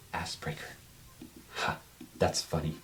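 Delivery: low, very soft mutter. Acted. Crouched prisoner repeats what she overheard, muttering to herself. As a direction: mumble it to yourself, barely audible. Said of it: "she's, like, whispering to them".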